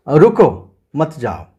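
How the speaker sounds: रुको set apart, brief pause, then मत जाओ; pause after रुको. Shown as a demonstration. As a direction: break after रुको, keep मत जाओ together. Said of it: "मतलब आपको रुकना है".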